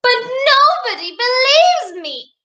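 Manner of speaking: put-on high, swooping childish wail; hammed-up storybook complaint; wailing princess. A narrator's reading. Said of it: "she wailed".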